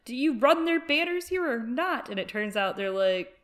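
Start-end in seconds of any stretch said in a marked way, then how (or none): 0.1-2.1 much higher, louder mock-earnest demand; mock Brienne plea. Brienne demanding the men honor their oath, high mock plea. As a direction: squeak it out like an indignant knight. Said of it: "She's like".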